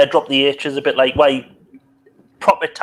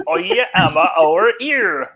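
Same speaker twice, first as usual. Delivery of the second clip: put-on cider-drinker West Country accent; 5 semitones higher, 4 dB louder, slower than usual. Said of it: "you sounded more like you were gonna drink cider".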